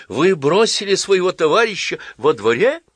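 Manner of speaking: high, loud shocked cry; horrified exclamation. A narrator's reading. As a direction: gasp it out in horror. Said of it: "со священным ужасом воскликнула".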